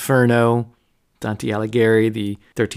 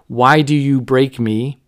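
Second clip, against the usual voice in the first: mild retold yell; 3 semitones higher, 4 dB louder, slower than usual. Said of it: "and it yells".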